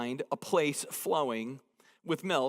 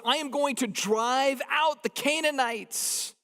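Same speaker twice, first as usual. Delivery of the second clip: God promising Moses, high and booming; 7 semitones higher than usual. Retold or imitated. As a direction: proclaim it like God listing foes. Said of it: "He says".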